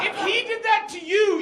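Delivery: ordinary talk, nothing marked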